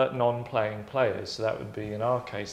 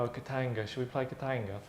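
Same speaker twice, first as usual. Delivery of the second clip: quiet, offhand, throwaway suggestion; underplayed, half-interested delivery; unenthusiastic; 10 dB quieter, faster than usual. Shown as a demonstration. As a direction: toss it out softly with no fanfare. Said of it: "That's how you say it".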